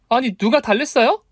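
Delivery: high-pitched, indignant retort; sudden outburst of anger. A narrator's reading. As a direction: flare up before you can help it. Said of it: "저도 모르게 버럭 화를 냈어요". (버럭 화내는 높은 목소리)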